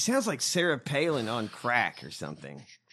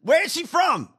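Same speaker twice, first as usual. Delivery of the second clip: higher and louder disbelief; exasperated; 10 semitones higher, 5 dB louder than usual. Real, not acted. Asking the cohost where she is from, exasperated. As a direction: demand an answer, incredulous. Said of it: "exasperated asking cohost".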